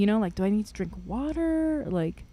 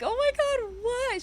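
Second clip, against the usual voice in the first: high-pitched acted disbelief; mock amazement. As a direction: squeak it in put-on astonishment. Acted amazed reply to fan.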